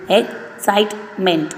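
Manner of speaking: "excitement" in three slow beats; slow syllable by syllable. Shown as a demonstration. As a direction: unpack "excitement" slowly. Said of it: "three syllable".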